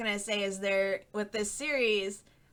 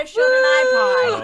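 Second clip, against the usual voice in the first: excited shout; 12 dB louder, slower than usual. Real, not acted. Excited announcing podcast themes.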